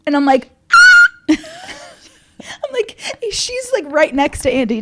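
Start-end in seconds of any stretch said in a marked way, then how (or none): 0.7-1.2 a high, loud burst of laughter; shrieking laugh. Real, not acted. Laughing at Brandy's outburst, a shrieking laugh. 1.3-3.8 words squeezed through laughter; laughing. Real, not acted. Still amused by Brandy, talking through laughter.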